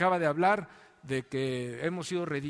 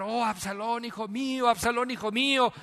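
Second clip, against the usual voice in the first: higher, louder grieving cry of a father; a retold father's lament, voiced higher; mock wailing cry; 7 semitones higher, 5 dB louder than usual. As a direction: cry out for a lost son, rising. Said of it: "se pone a gritar".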